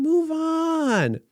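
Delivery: dismissive mock chant of "move on"; brushing off a scandal in a high mocking voice; sarcastic. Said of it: "I'm being sarcastic here again".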